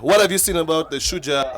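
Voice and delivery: ordinary delivery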